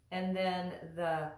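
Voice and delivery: usual speaking voice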